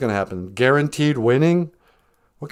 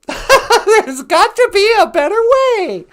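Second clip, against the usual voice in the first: much higher, slow, laughing ad voice; hammed-up infomercial; 7 dB louder, slower than usual. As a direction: oversell it like a cheesy TV ad, laughing. Infomercial line, hammed retake.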